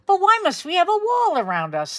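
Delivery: put-on high pleading boy voice; boys asking. Sons questioning their mother, high voice. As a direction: ask it up high like young sons. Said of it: "they sometimes asked".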